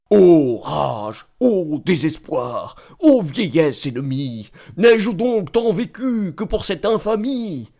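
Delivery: slow, drawn-out mock-tragic declamation; declaiming tragedy. Mocking a man who declaims alone on stage, mock-tragic declamation. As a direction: declaim it like an overblown Corneille hero. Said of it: "se met à déclamer".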